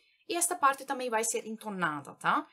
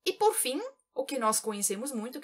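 usual speaking voice

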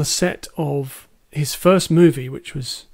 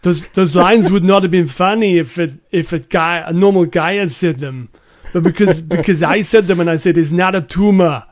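put-on heavy Austrian Arnold Schwarzenegger accent, louder; Arnie accent; 5 dB louder than usual. Quoting Arnie from the interview in his Austrian accent. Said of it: "I'll try and do it in an Arnie accent".

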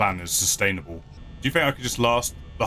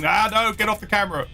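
panicked yell; 11 semitones higher, 3 dB louder than usual. Real, not acted. Yelling at animatronic.

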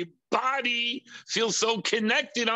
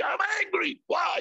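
sulky angry reply; 13 semitones higher than usual. Retold or imitated. Acted quarrel about anger.

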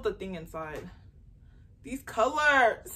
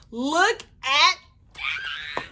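teary, drawn out; 7 semitones higher, 7 dB louder, much slower than usual. Real, not acted. Stretched, teary repeat.

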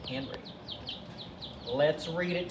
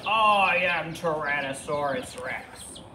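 slow, drawn-out monster proclamation; booming dinosaur cry. Acted. Tyrannosaurus demands breakfast from the forest, slow monster cry. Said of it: "He cried".

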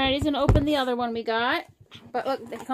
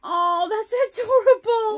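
overwhelmed by cuteness; 9 semitones higher, 7 dB louder than usual. Real, not acted. Gushing over toy cat.

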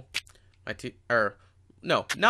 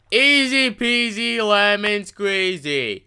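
high, slow sing-song easy peasy lemon squeezy; 9 semitones higher, 4 dB louder, much slower than usual. Acted. Kid mocking a camp man's catchphrase, high and slow.

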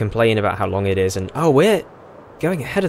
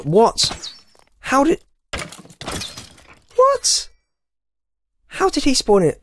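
shocked disbelief; slower than usual. Real, not acted. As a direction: squeak out your shock. Confused by sudden spawn.

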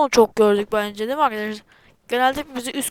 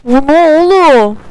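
loud, slow, high shocked yell; shocked shout; 4 semitones higher, 13 dB louder, slower than usual. Real, not acted. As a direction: blurt it out in shock. Right after jumpscare.

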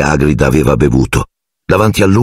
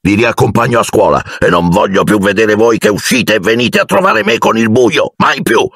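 angry scolding; 7 semitones higher than usual. A narrator's reading. Hagrid scolding, escorting them back.

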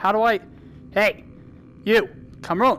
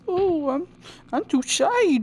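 high, hammed-up bashful squeak; higher mock-timid voice; mock shy voice; 9 semitones higher, slower than usual. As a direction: play the shy one, coyly. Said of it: "'Cause I do the, you know".

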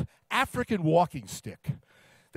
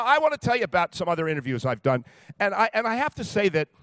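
ordinary talk

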